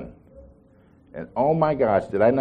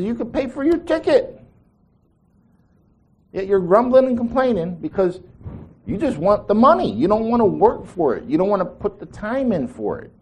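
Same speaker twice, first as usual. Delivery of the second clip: preacher reliving how he scolded his lazy workers; retold stern rebuke; 8 semitones higher than usual. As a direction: lecture them sharply from way up in pitch. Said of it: "I said".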